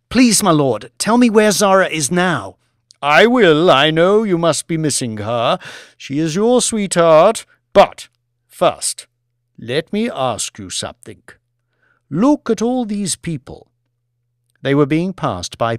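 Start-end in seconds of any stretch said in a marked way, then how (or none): 0.1-2.6 raised, pressing plea; impatient plea. Acted. Banks begs the Lord for news of Zara, losing patience. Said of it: "unable to maintain his patience". 3.0-13.7 raised, theatrical aristocrat; commanding lord. Acted. Lord puts off Banks's plea, commanding lord voice.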